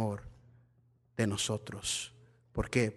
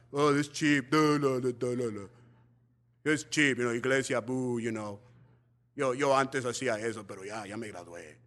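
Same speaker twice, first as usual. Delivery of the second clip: low, lazy sneering voice; mock scornful ex-member; 8 semitones lower than usual. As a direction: sneer like a teen who left church. Imitating scornful ex-youth.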